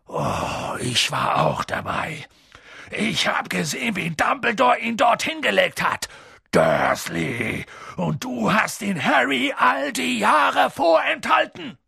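hoch und laut anklagend; zorniger Riese. A narrator's reading. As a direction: klag Dursley wütend an. Hagrid wirft Dursley vor. (Hagrid klagt Dursley an, zornig)

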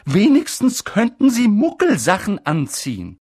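high, loud reproach; scolding McGonagall. Acted. McGonagall lectures Dumbledore, loud and reproachful. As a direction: lecture about careless people.

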